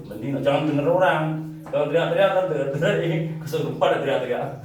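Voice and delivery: normal talking voice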